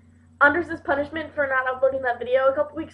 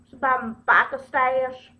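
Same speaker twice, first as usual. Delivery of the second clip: put-on Southern-accented biker; 4 dB louder than usual. Vlogger showing the biker stash, mock Southern accent. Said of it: "my biker's got a southern accent".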